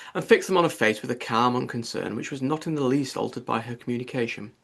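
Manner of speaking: plain reading voice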